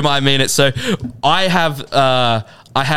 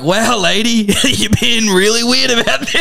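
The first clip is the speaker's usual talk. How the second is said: laughing retort; 7 semitones higher than usual. Retold or imitated. Retelling telling off odd passenger.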